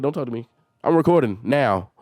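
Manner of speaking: ordinary talk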